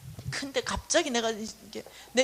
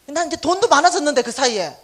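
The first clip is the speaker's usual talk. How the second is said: usual speaking voice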